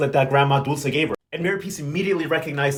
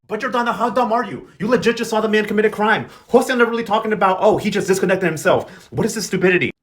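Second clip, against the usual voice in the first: higher, punchy mock outrage; exasperated scolding; 5 semitones higher, 4 dB louder than usual. Recapper scolds Jordana for believing the lie, exasperated. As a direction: tell off a clueless character in disbelief. Recapper berates a foolish character.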